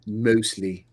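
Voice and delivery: lightly imitated accent of Newt; a hint of Newt's accent on one word. Shown as a demonstration. Showing how Newt talks, lightly put-on accent. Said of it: "had this accent like this".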